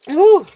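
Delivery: short high, loud woo; excited whoop. Real, not acted. Seller after buyers made her night, thrilled whoop.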